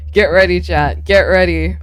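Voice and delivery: loud rallying call; hyping up chat. Real, not acted. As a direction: rouse the chat loudly.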